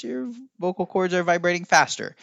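high voice. Shown as a demonstration. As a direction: lift into a high voice for the demo. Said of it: "If you talk in a high voice".

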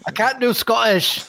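high-pitched mock Scottish protest; attempted Scottish accent. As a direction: put on a hammed-up Scottish accent. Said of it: "read this with a Scottish accent".

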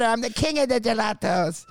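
mock Irish brag; badly faked Irish boast; lightly voiced Irish accent. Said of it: "I can't even do the accent".

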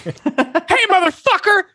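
high-pitched imitation of a cursing game character; mock angry call. Host quoting Ellie's game line to co-hosts, high mock yell. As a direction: hurl the curse out high, in play.

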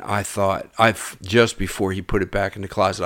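ordinary talk, nothing marked

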